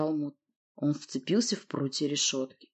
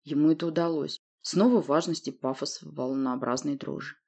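usual speaking voice

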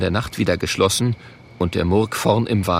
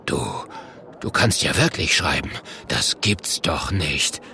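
low, halting amazement; 6 semitones lower, 4 dB quieter than usual. Acted. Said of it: "traut seinen Augen nicht".

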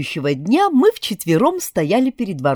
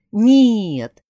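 usual speaking voice

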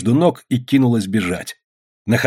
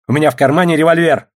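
raised, loud warning about a gun; high, forceful, agitated warning; 5 semitones higher, 5 dB louder, faster than usual. A narrator's reading. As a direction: blurt out an armed threat in a scuffle. Agitated threat in a scuffle.

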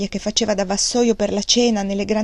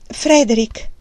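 a sudden louder cry of the name, as if in sharp pain; 2 semitones higher, 5 dB louder, slower than usual. A narrator's reading. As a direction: blurt the name out like a stab of pain. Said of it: "gridò inaspettatamente".

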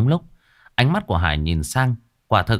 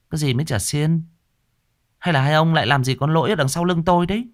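suspicious woman; 8 semitones higher, faster than usual. A narrator's reading. Hương catches them suspiciously.

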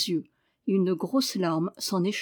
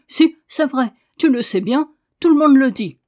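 high, much louder yelling; shouting back; 5 semitones higher, 10 dB louder than usual. A narrator's reading. Girl yelling back at the sick boy, full shout. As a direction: shout him down.

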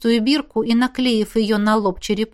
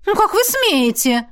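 high indignant outburst; affronted, pitch jumping up; outraged protest; 9 semitones higher than usual. A narrator's reading. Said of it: "возмутилась она".

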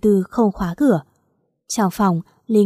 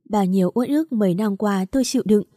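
ordinary delivery

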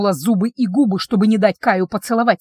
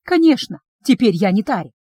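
plain reading voice

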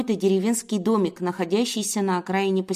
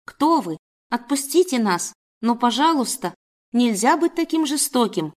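higher-pitched imploring wail; pleading lament; 4 semitones higher than usual. Acted. Captive woman playing victim to her guard, pleading lament. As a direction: beg like a helpless victim. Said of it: "запричитала".

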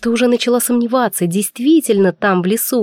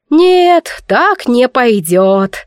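menacing declaration; 2 semitones higher, 4 dB louder, much slower than usual. Acted. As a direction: bark it out, then lay it down slowly. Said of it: "грозно объявила седовласая женщина". (грозно, громко и медленно)

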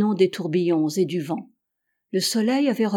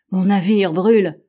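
loud, alarmed exclamation; anguished outcry; 7 dB louder than usual. A narrator's reading. Captain tells companions his ship burns, loud alarmed cry.

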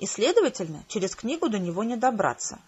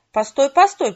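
urgent call; 4 semitones higher, 8 dB louder than usual. A narrator's reading. Cat stops the game.

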